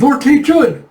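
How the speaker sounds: high, loud cry of concern; flustered shout, pitched well up; alarmed call. Acted. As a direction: call urgently to your master. Alarmed soldiers calling their lord.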